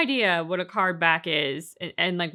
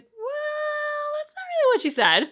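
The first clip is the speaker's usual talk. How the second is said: a much higher, squeaky-cute put-on voice; mock companion voice; slower than usual. Retelling the game to a co-host, in a high sidekick voice. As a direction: pitch it way up like a tiny sidekick. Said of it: "And it's like".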